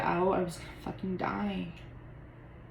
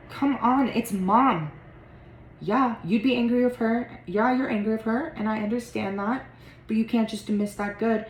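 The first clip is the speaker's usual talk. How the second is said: louder, pressing, exasperated; pleading argument; 3 semitones higher, 4 dB louder than usual. Acted. Sibling urging the other to give mom a chance, heated. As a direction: push back hard on the eye-roll.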